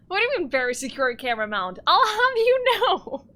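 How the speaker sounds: loud, high huffy protest; mock indignant. Mock indignant at chat remark.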